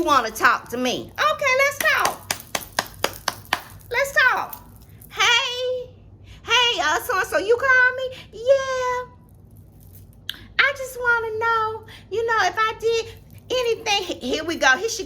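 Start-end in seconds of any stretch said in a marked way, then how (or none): 1.1-4.6 much higher, chirpy voice; mock eager agreement. Vlogger mocking herself agreeing to talk, high and chirpy. 5.1-8.1 much higher, breezy phone voice; mock breezy greeting. Vlogger calling the bully back, high and breezy. 8.3-14.0 much higher, innocent-sounding voice; imitating the innocent caller. The bully asking on the phone, high and fake-innocent.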